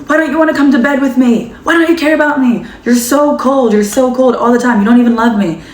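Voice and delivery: put-on angry boyfriend. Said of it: "He was like".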